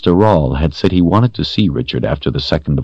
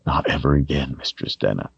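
trembling whisper; 6 dB quieter than usual. A narrator's reading. Richard tender, choked up.

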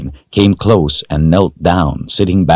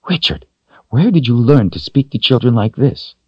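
scolding woman; 7 semitones higher than usual. A narrator's reading.

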